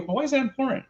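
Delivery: high-pitched feigned cluelessness; acting dumb. Teacher questions student about prototype, acting dumb. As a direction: play dumb, pitch right up. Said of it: "I'm gonna act dumb here".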